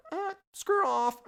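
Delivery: high, slow squawking parrot; squawky parrot. Acted. Parrot snubbing the host, high squawky voice.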